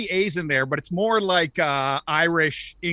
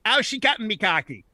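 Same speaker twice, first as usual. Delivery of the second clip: higher-pitched, run-together Newfoundland greeting; Newfoundland accent; 5 semitones higher than usual. Shown as a demonstration. Guest demonstrates a typical greeting, Newfoundland accent. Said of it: "a typical Newfoundland greeting".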